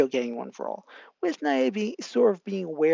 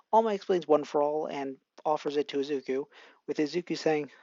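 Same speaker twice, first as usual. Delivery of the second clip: plain reading voice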